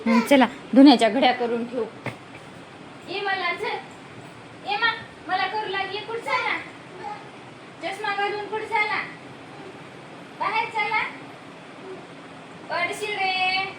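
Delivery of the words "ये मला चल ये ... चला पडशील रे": sing-song coaxing, much higher and softer. Real, not acted. Mother coaxing her toddler to walk out, high soft baby talk.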